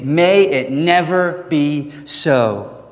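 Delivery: slow, measured, firm delivery; forceful. Acted. Preacher restating Paul's answer to the congregation, slow and forceful. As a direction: space it out word by word, firmly. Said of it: "this forceful language of saying".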